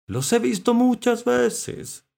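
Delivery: high, loud, slow voice; mollusc recalling. A narrator's reading. Mollusc recalling the scientists, high and slow. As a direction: stretch it out high. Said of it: "dijo Mitilo".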